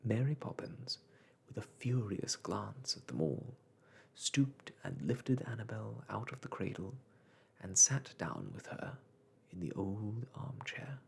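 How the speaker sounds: usual speaking voice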